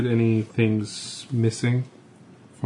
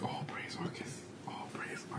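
hushed cultist chant; cultist whisper; 18 dB quieter than usual. Pretend. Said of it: "You'd be like".

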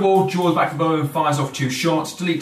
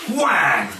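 sound effect shout; 3 semitones higher, 3 dB louder than usual. Acted. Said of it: "Side of bacon goes flying".

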